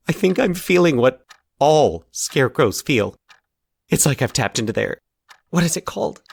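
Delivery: a hint of a troubled patient pleading to be believed; lightly voiced anxious Charlie. Charlie pours out his nightmare theory.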